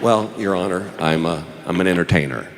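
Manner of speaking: low, hesitant answer; reluctant admission. Retold or imitated. Juror telling the judge his job, low and reluctant. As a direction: drop the voice and stall before confessing.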